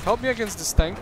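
loud, high urgent shout; raging gamer. Real, not acted. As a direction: yell for help over the fight. Tank fire, calls for help.

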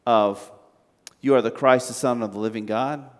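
normal talking voice

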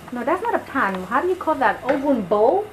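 normal talking voice

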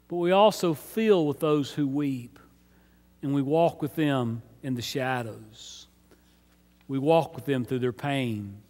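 low, soft and solemn; hushed compassion. Real, not acted. Pastor tells congregation to walk with the hurting, hushed compassion. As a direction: drop the voice right down in sympathy. Sharing the grief of those weeping.

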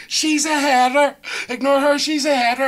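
high, loud mock girl's shout; imitating a girl. Girl jeering to her mates about him, high mocking shout. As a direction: shriek it up high like the girl, hammed-up. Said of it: "one of the girls went".

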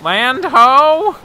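high pirate shout; pirate call. Pretend. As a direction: call it from the crow's nest. Pirate role calling out.

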